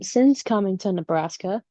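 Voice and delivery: ordinary delivery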